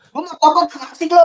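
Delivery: very high mock protest; joking Firaun quote. Teacher retells Firaun protesting to Jibril, high mock complaint. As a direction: put on a high, comic complaining voice. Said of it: "kata dia kan".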